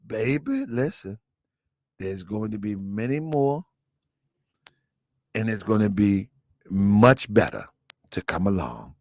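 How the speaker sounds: put-on low, soft daddy voice talking his girl down; tender father, low and quiet; hushed dad soothing his daughter; soft fatherly advice. Father voice again.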